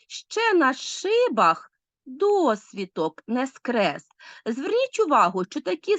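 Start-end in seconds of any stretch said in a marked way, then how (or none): 0.1-4.0 ще на шибах досвіток не скрес very slowly, stretching ШИ, ДО, СКРЕС; slow scansion of "ще на шибах досвіток не скрес", pausing between phrases; marking word stresses. Shown as a demonstration. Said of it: "Спочатку ми повинні розставити наголоси".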